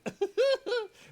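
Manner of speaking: high loud laugh; burst of laughter. Real, not acted. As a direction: crack up out loud.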